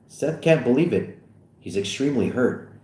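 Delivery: ordinary talk, nothing marked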